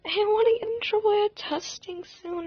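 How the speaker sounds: high, fast, near-tearful panic; panicky. Retold or imitated. Student scared of trouble before testing, panicky and teary. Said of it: "like, panicking".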